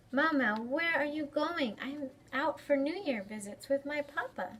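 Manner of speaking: put-on lively read-aloud for kids; story time reading. A narrator's reading. Librarian reading a picture book to children, lively. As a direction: read it brightly to a young audience.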